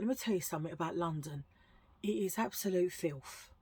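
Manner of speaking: normal talking voice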